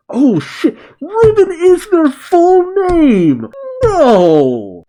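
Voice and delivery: very high, drawn-out disbelieving wail; shocked outburst. Real, not acted. Narrator suddenly realizes Ribbon is a surname, shocked. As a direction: squeal in stunned realization, stretching the words. Reacting to surprise revelation.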